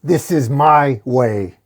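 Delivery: loud, slow declaration; half-shouted, each word drawn out; loud but contained defiant yell; mildly screamed retort. A narrator's reading. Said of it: "Batman screams at Father Peters".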